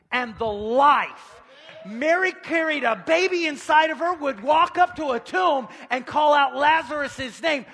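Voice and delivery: loud, high, building preacher's cry; full-force preaching. Real, not acted. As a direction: preach it out, punching the key words.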